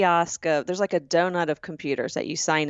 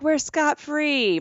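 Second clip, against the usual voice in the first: gleeful; 7 semitones higher, much slower than usual. Retold or imitated. Gloating in the elevator.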